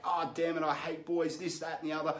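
ordinary talk

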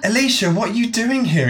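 high-pitched startled greeting; surprised exclamation. A narrator's reading. Nicole finds Alicia here unexpectedly, exclaiming in surprise. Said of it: "exclaimed Nicole".

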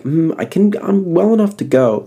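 high, self-convincing voice; sick person talking themselves into going. Retold or imitated. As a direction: talk yourself into going out. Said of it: "and you're like".

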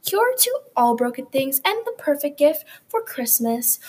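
hammed-up TV ad pitch; toy commercial. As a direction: sell it like a late-night infomercial. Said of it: "a quick ad from our sponsor".

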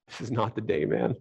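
low, soft grumble to himself; under-the-breath reluctance; reluctant aside. Retold or imitated. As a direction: retell a weary inner protest, quietly. Reluctant inner reply to a request.

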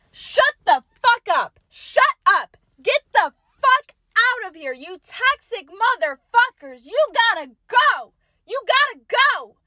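high, loud, slowed mock fury; angry rant. Real, not acted. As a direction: tell them off hard. Ranting at listeners.

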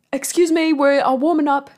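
loud, high, badly faked British accent; Daniel Craig. Actor shooing sponsors during warm-up, imitated in a poor British accent. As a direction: project a botched British accent from the stage. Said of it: "should I do his accent?".

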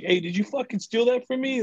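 high-pitched mock outrage; mock accusing. Shopper accuses another of stealing, mock outraged. Retold quarrel over stolen cart items.

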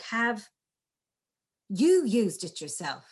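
teacher demo, emphasis on "you"; stress on you. Shown as a demonstration. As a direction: lean into "you" after the pause. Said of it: "you could emphasize different words".

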